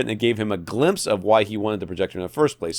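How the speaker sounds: normal talking voice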